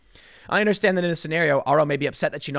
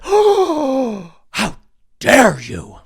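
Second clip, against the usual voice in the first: high, loud hammed-up indignation; mock outrage; 8 semitones higher, 8 dB louder, much slower than usual. Woman mocking offense at a compliment, hammed-up outrage. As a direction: ham up offended shock. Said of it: "She'd be like".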